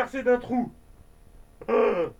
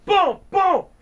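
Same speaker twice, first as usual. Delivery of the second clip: coups de feu criés; 9 semitones higher, 9 dB louder than usual. Acted.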